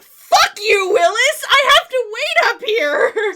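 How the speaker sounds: loud, high, hammed-up rage; mock furious yell. Collector reenacts yelling at her rival, mock furious. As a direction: scream the grudge in jest, slowly at the end. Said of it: "and I'm like".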